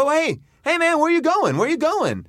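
high, eager hey man; excited high guy. Retold or imitated. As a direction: call after him with high excitement. Excited guy calling after him.

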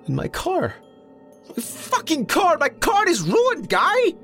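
high whine building to a loud wail; shrieky, high-pitched despair; distraught wailing. A narrator's reading. Mo wailing over his wrecked car.

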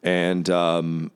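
ordinary talk

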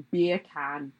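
bacon said in a mock Jamaican accent; imitated Jamaican accent. Retelling how she said bacon in a Jamaican accent, mock Jamaican. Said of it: "Jamaican accent".

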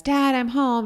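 put-on high kid's call home; child calling out. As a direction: call out like an excited little boy.

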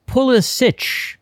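"Pulisic" ending in a CH sound, drawn out. Shown as a demonstration. Co-host relaying a disputed name, "Pulisic" with a CH ending. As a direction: say "Pulisic" with a final "ch" as in "rich". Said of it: "C H sound".

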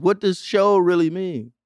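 usual speaking voice

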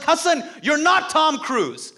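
very high, slow mock-shouting father; hammed-up dad yelling with a laugh; dad scolding comedian son, mock shout; dad's shouted put-down. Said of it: "He's like".

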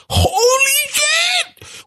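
high, drawn-out exclamation; high-pitched amazed holler; amazed outburst. Real, not acted. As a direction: cry it out in awe, stretched long and way up high. Amazed reaction to star pairing.

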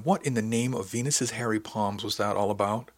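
plain reading voice

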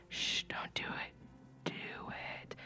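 hushed, unvoiced tease; mischievous whisper. Host whispering a dare to her listeners, mischievous whisper.

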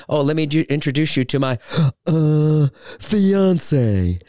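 mock gasp before a grand "fiance"; hammed-up posh introduction; showy fiance intro. Shown as a demonstration. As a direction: introduce her as if it's a big reveal. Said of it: "That's what I do".

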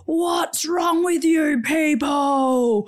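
high, very slow, odd voice; strange man. Retold or imitated. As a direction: drag out the words like someone not all there. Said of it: "He was like".

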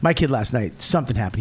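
usual speaking voice

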